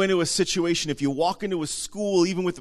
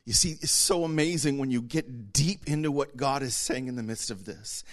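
normal talking voice

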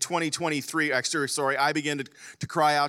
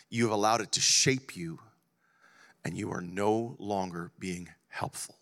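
low solemn voice of God; 5 semitones lower, 4 dB quieter than usual. Retold or imitated. Preacher voicing God's words to himself, low and grave. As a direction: deliver God's rebuke gently and gravely.

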